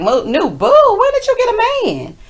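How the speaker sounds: squealing high when did you get a man; loud high squeal of surprise; excited gossip. Retold or imitated. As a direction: gasp out the gossip. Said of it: "they was like".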